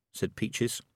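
ordinary delivery

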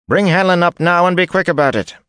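high, loud order shouted from afar; sharp call. A narrator's reading. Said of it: "distant voice called sharply".

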